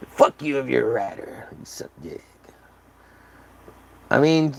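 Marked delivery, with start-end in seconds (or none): 1.6-2.2 breathy whispered insult; hushed mock insult. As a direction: whisper it as a sneaky aside.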